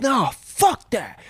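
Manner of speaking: high, slow scornful dismissal; defiant refusal. Retold or imitated. Coworker refusing to get off the lift. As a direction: brush it off with contempt. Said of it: "He's like".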